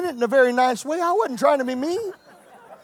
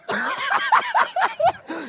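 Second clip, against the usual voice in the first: high shouted laugh; 3 dB quieter than usual. Real, not acted. Preacher howling before voicing Jesus. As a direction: howl with laughter.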